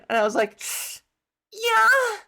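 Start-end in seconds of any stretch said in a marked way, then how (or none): 1.5-2.2 high, loud, drawn-out yeah; uneasy high yes. Retold or imitated. Applicant agreeing to do Jamaican despite herself, high strained yes. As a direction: squeak out an over-eager, uneasy yes.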